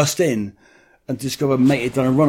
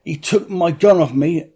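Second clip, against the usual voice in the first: higher, lisping as if teeth are knocked out; a slightly raised, mushy voice; mock injured caller; 4 semitones higher than usual. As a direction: play a beaten-up victim begging the police. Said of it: "like I've had some teeth knocked out".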